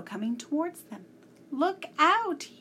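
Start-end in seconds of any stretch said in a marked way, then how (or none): 1.5-2.5 high, loud urgent warning; warning cry. A narrator's reading. The professor crying out to warn the boys, high and loud. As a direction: yell to get them out of the way. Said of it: "he cried".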